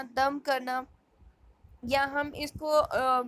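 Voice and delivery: normal talking voice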